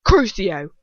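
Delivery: loud, clear curse; ringing command. A narrator's reading. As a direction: let the spell ring out. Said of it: "deep voice rings out clearly".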